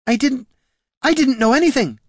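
high-pitched frightened denial; panicked. Acted. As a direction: stammer out your innocence in a panic. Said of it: "felt the panic rising again".

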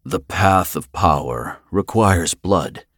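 slow, solemn intoning; intoned. A narrator's reading. Finn claims his prize before the totems, slowly intoned. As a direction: intone it word by word, drawn out. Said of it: "he intoned".

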